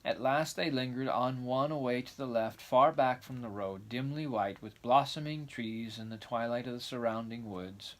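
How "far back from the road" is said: ordinary delivery, nothing marked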